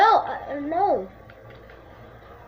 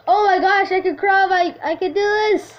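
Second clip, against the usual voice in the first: loud, high, thrilled; thrilled shout of success; excited cheer; 5 semitones higher, 7 dB louder than usual. Real, not acted. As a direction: cheer yourself on loudly.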